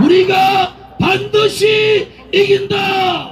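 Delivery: slow, drawn-out slogan shouts; roaring rallying speech. Real, not acted. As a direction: chant each slogan for the crowd to echo. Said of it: "큰 소리로 제 구호를 따라해 주시기 바랍니다".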